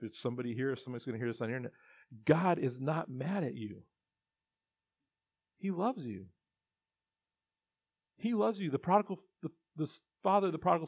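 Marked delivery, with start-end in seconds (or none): 2.3-3.8 slow emphatic. Real, not acted. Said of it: "repeated for emphasis". 5.6-8.7 tender, higher, softer. Real, not acted. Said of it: "repeated tenderly".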